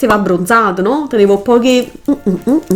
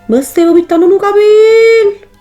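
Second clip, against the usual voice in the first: surprised exclaim; 11 semitones higher, 5 dB louder than usual. Real, not acted. Exclaiming at own makeup.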